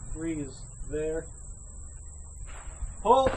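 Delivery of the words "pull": loud high call; barking pull for skeet. Real, not acted. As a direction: call the target out sharply. Calling for the clay target.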